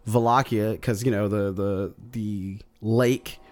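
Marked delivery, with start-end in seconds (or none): none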